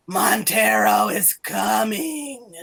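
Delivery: slow, deep gravelly possessed voice; deep gravelly prophecy. Acted. Professor in a trance delivering a prophecy, slow deep gravelly voice. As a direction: drag it out like a possessed seer. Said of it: "like, this deep, gravelly voice".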